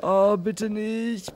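ordinary delivery

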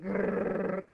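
loud mock growl for a tank. Gamer voicing the Mammoth tank, loud growl. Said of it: "and you're like".

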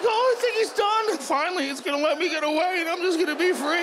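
high-pitched, relieved hamster voice; high and hopeful, building relief; hopeful little hamster. Acted. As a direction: voice a tiny victim daring to hope for freedom. Hamster thinks the torture is over.

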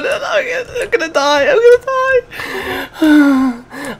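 very high, slow panicked squeal; terrified wail. Real, not acted. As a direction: squeal that you're doomed. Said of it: "I'm terrified".

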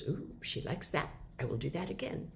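a husband quietly pleased, planning to repeat it; husband's pleased inner voice. Retold or imitated. The husband softly delighted his coffee is appreciated. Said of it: "And he goes".

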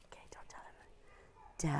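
hushed whisper so the class won't hear. Real, not acted. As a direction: whisper the secret clue, barely audible. Secret clue kept from the class.

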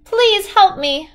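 high, slow plea for help; a lost girl begging strangers for help; crying out for help. A narrator's reading. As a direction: beg the crowd for help, drawn out. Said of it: "Kristen cried".

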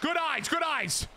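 high, peppy teammate shout-out; encouraging call. Pretend. Player encouraging his teammate, high peppy call. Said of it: "I gotta encourage my teammate".

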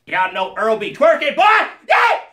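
high, shouted hype character voice; Earl voice. Acted. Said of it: "Earl is not easy on the voice box".

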